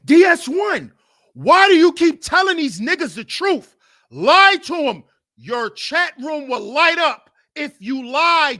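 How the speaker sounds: loud, high pushy tempter; loud nagging devil. Retold or imitated. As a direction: shove him toward lying. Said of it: "It's the devil".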